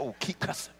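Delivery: whispered, teasing urge to keep going; mock-whispered encouragement. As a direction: hush it out like egging him on. Mimics pastor hushing the cusser.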